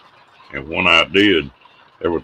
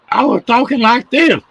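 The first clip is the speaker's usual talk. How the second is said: much higher and louder, demoing the jump; 4 dB louder than usual. Shown as a demonstration. Storyteller shows the host how high his voice went, much higher and louder. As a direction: push the voice up high to show it. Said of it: "I said my voice went up about five octaves".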